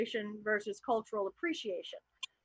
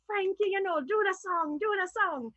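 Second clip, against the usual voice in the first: high, coaxing party-guest voice; family requesting; 7 semitones higher than usual. Retold or imitated. Relatives at the barbecue begging Frankie, mock coaxing. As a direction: egg her on to sing.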